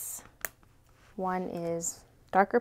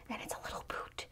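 hushed whisper reveal; 18 dB quieter, faster than usual. Real, not acted. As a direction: whisper the discovery.

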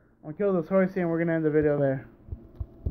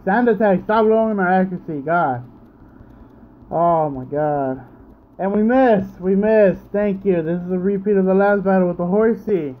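frustrated rant; 3 semitones higher, 6 dB louder than usual. Real, not acted. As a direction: vent at the game. Raging at missed attacks.

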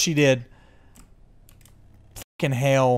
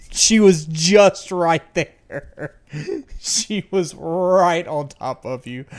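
laughing relief; 4 semitones higher, 7 dB louder, slower than usual. Real, not acted. Laughing after the scare.

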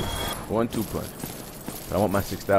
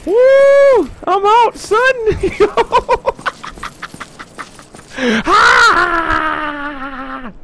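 high, loud triumphant screaming; panicking gamer; 11 dB louder, much slower than usual. Real, not acted. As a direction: scream with relief and laughter. Escaping danger in game.

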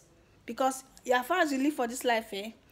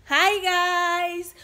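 high, loud, stretched-out hello; excited greeting; 6 semitones higher, 7 dB louder than usual. Real, not acted. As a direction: call out to the fans, holding the vowel. Excited greeting after cheering milestone.

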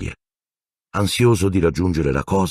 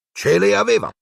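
proud; 3 dB louder than usual. Acted. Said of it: "rispose Sir Cadogan fiero".

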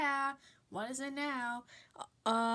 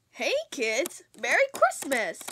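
put-on louder, bright call to the kids; cheery host greeting; 8 dB louder than usual. Show host greeting kids at Christmas, loud and cheery.

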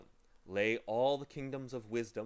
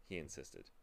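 ordinary delivery

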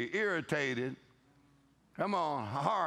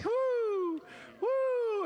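excited whoop. Real, not acted. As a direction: throw out a high joyful woo. Preacher whoops at congregation.

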